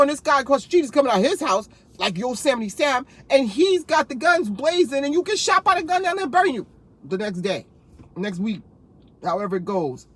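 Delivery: normal talking voice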